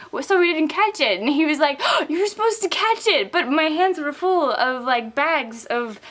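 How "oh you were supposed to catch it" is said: high-pitched imitation of a disappointed man; mock complaint. Man who threw the orange, mock complaining. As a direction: protest in exaggerated dismay.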